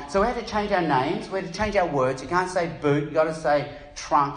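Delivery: normal talking voice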